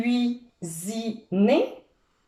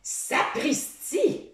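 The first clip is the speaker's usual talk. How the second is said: slow breathless whispered exclamation; Sammy, stunned, gasps; startled gasp; 7 semitones higher, 4 dB quieter, slower than usual. A narrator's reading. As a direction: gasp it out in fright. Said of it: "s'écrie Sammy".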